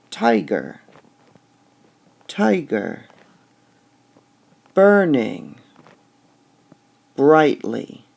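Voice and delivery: "tiger, tiger, burning brightly" with each first syllable hit loud; stressed first syllables. Shown as a demonstration. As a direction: beat out the trochees, first syllables strong. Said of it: "So we would say".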